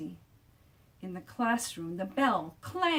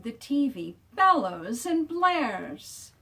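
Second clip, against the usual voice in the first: louder and drawn out; loud, slow; 5 semitones higher, 7 dB louder, slower than usual. A narrator's reading. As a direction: act out the blaring TV. Sound words acted out.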